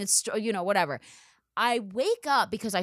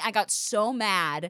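ordinary talk, nothing marked

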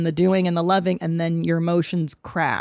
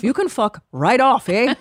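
put-on light Canadian accent, higher, ending on eh; a hint of a Canadian husband's brush-off; 6 semitones higher, 3 dB louder than usual. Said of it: "To quote your Canadian born husband".

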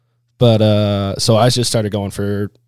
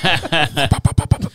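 quick light pops; imitated popping; 8 semitones higher, 4 dB louder, slower than usual. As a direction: pop like a flapping jacket. Imitating the jacket flap sound.

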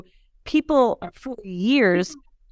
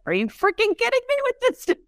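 much higher, half-acted nagging wife; mock exasperated wife; 12 semitones higher than usual. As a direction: lightly play the wife scolding over another bottle. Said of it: "the wife is like".

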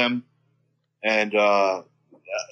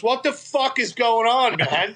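higher, outraged what the fuck; indignant yell; 7 semitones higher than usual. Retold or imitated. Drunk Gary objecting to the commotion, high outraged protest.

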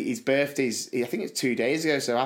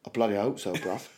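Cockney John; 4 semitones lower, 4 dB quieter than usual. Retold or imitated. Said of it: "I give Cockney John a bell".

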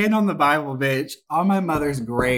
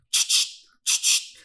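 creepy clicking noise. Retold or imitated. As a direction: rattle it out like an unsettling waiter. Imitating the server's weird noise.

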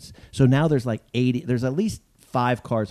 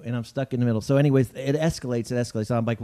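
ordinary talk

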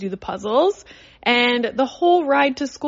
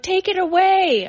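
imitating child; 9 semitones higher, 4 dB louder than usual. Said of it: "And he's like".

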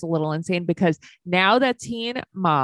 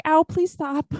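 mock pained plea; 9 semitones higher, slower than usual. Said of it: "I'd be like".

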